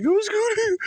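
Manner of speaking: very high squeal breaking into a laugh; mock erratic woman. Mocking the erratic woman, high squeal into a laugh. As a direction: start a squeal then crack up laughing. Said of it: "she's all erratic".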